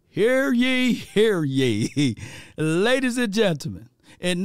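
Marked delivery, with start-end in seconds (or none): 0.1-2.1 mock town crier proclamation. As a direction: announce it like a herald. Announcing stats like a proclamation.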